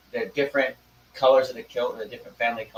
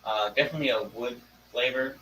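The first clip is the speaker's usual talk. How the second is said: usual speaking voice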